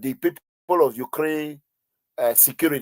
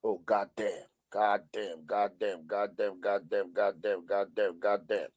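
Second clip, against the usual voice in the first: low, much softer repeated God damn; muttered curse; 8 semitones lower, 12 dB quieter than usual. Retold or imitated. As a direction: mutter it under your breath, over and over. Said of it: "He said".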